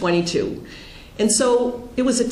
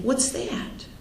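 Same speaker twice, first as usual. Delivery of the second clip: hushed question; 7 semitones higher, 7 dB quieter than usual. Retold or imitated. Asking about HIV diagnosis.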